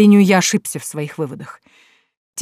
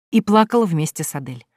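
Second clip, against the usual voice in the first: ordinary delivery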